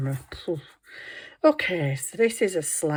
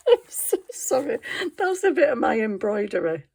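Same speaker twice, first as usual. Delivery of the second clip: giggling through the words, louder; real laughter; 6 semitones higher, 5 dB louder than usual. Real, not acted. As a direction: laugh and apologise at once. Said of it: "you've got to laugh".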